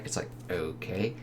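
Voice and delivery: ordinary delivery, nothing marked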